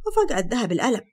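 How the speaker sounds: ordinary delivery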